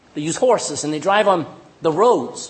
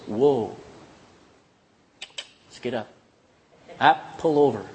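lower, softer, steady call to the horse; calm command; 7 semitones lower, 4 dB quieter than usual. Retold or imitated. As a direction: settle the horse with an easy voice. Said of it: "that horse knows the voice of its owner".

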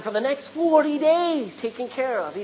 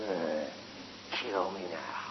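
weary groan; 10 dB quieter, slower than usual. Acted. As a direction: lower and softer, groaning and fed up. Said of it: "But is Elijah? He's just".

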